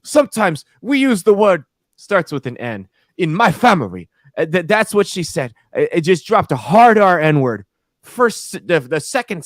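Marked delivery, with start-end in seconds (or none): 0.0-4.1 higher imitation of a grandmother's broken English, repeated. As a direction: repeat her broken English line, pitched up. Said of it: "in her broken English".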